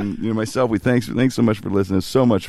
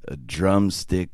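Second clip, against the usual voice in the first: slow, lower, dramatic narrator read; Facenda impression; 6 semitones lower, 3 dB quieter, much slower than usual. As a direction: intone it like the NFL Films voice. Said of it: "I remember Facenda's line".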